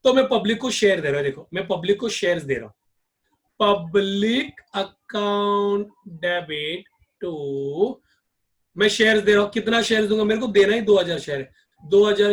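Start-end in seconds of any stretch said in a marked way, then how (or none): 3.6-7.9 very slow, drawn out entry; slow dictation. Shown as a demonstration. Teacher dictating bank account debit entry slowly. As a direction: dictate each word slowly.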